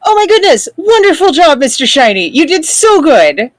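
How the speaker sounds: high, excited cheering on a pet; thrilled high-pitched congratulations; gushing praise. Acted. Character praises creature for winning duel.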